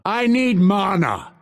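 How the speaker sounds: high, insistent plea; insist, short of a scream; urgent demand. Acted. Fighter out of mana, urgent demand. Milder of two takes.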